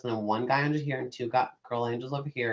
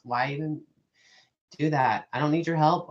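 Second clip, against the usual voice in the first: ordinary delivery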